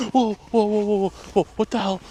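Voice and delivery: high, loud panicked exclamations; terrified at something in the dark; frightened gasp. Real, not acted. Sees something move, gasps.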